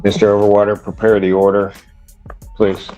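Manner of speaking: ordinary talk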